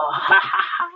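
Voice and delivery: staccato ha-ha-ha; gloating monster laugh after a chant; villainous laugh. A narrator's reading. Tyrannosaurs relish eating duckbills, villainous laugh.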